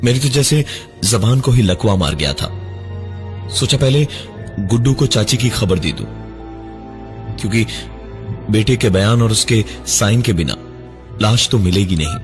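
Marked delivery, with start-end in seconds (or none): none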